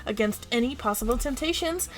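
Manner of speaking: showy host voice, stretching the big words; punchy broadcast patter with swooping emphasis; game show announcer. Acted. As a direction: introduce the contestants like a TV host.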